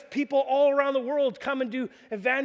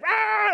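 high mock baby cry; mimicked baby wail; 11 semitones higher, 5 dB louder than usual. Father imitates his crying baby in the crib, high and squealing. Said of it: "she's like".

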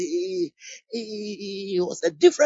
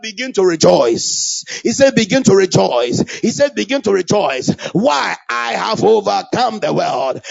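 loud preaching; 10 dB louder than usual. Real, not acted. Preaching at full force.

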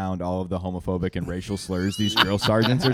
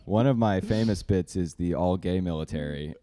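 ordinary delivery

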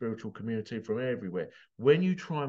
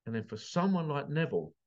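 normal talking voice

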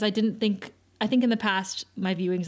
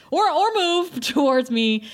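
retold urgent plea; 6 semitones higher, 5 dB louder, slower than usual. Acting Dawn's plea as tower shakes.

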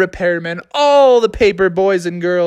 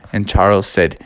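ordinary delivery

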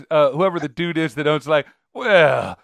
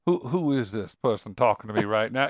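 dismissive; 6 semitones lower, 6 dB quieter than usual. Retold or imitated. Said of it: "he would say to his assistant".

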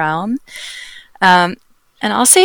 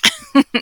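laugh. Real, not acted. Laughs after joke.

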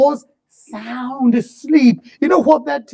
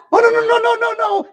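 a preacher mocking a frantic call for help; mock panic; 11 semitones higher, faster than usual. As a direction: plead frantically down the phone. Savior on phone panicking.

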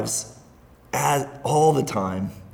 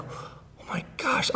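near-whispered, much higher, slowly; breathless and overcome with emotion; choked up; 13 semitones higher, 8 dB quieter, slower than usual. Retold or imitated. Father retelling his reaction to his daughter confiding, teary and high. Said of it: "I'm, like, crying".

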